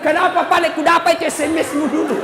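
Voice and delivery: accusing wife. Retold or imitated. Wife dredging up old grudge.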